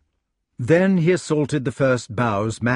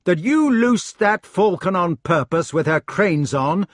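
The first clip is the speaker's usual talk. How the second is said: incredulous accusation; 8 semitones higher, 6 dB louder than usual. Acted. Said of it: "he asked".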